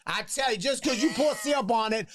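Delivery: high-pitched put-on "honey" voice; hammed-up character saying; mock folksy impression. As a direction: hand down mock wisdom in a high voice.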